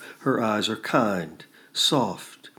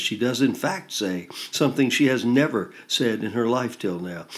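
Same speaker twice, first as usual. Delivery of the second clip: ordinary delivery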